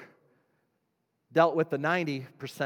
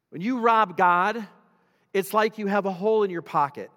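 raised pitch, loud, weighty phrasing; forceful scripture preaching; 7 semitones higher, 7 dB louder than usual. Real, not acted. Preacher reading Haggai to the church, loud and emphatic. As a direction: proclaim the verse to the whole room.

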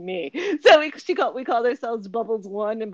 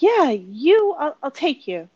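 louder, higher voice imitating the agent; agency woman; 6 semitones higher, 9 dB louder, slower than usual. Agency woman choosing a new client, imitated. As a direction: announce the pick like the agency woman, pitched up. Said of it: "so she's like".

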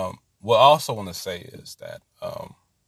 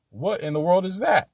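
startled disbelief; 10 semitones higher than usual. Retold or imitated. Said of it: "our first thought is like".

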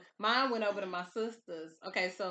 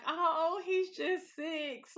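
tender sigh; 6 semitones higher, much slower than usual. Retold or imitated. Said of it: "I have these moments where I'm like".

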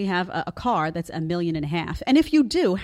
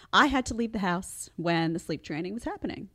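normal talking voice

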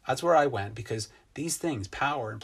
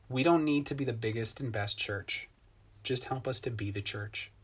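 prayer; 3 semitones lower than usual. Acted. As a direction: pray it gently for the church. Leads the congregation in prayer.

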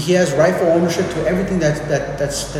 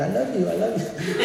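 higher, softer laughing toy voice; talking teddy bear; 4 semitones higher, 4 dB quieter than usual. Retold or imitated. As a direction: mimic a squeezed toy bear. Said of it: "teddy bears that you squeeze and they say".

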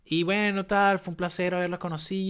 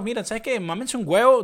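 higher, louder dismissive brush-off; defiant telling off; 7 semitones higher, 3 dB louder than usual. Pretend. Telling toxic coworkers off, defiant and higher. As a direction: tell them all where to go.

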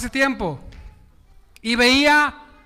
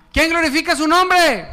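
shouted call; 5 semitones higher than usual. Shown as a demonstration. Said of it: "No, diga, "Yo, aleluya".

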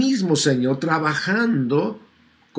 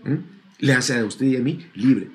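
ordinary delivery

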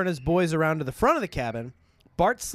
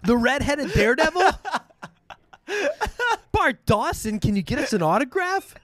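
put-on higher, eager starstruck kid; excited fan; 10 semitones higher than usual. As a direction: gush like a starstruck boy. Excited boys asking for autograph.

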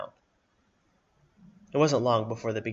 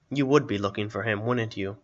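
plain reading voice

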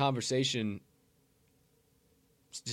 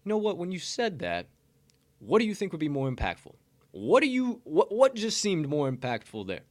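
lightly voiced curious listener, higher; attentive listener; 7 semitones higher, 3 dB louder than usual. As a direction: ask it like someone who really listened. Modelling a thoughtful reply.

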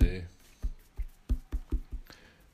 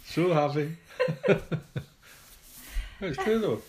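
giggling high through a grin; laughing; 10 semitones higher, 4 dB louder than usual. Real, not acted. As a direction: laugh while you say it. Laughing while forcing a smile.

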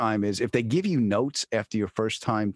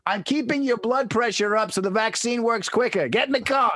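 raised, high-pitched holler; retold yelling back; 11 semitones higher than usual. Yelling to a friend in the road, retold yelling back. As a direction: shout back as if across traffic. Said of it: "And I'm yelling back".

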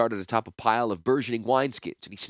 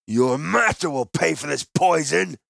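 slow, high, loud threat; raging merchant; 6 semitones higher, 4 dB louder, slower than usual. Acted. The wine seller rages at Gerard about his master. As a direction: bellow the threat word by word.

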